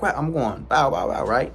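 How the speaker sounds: ordinary talk, nothing marked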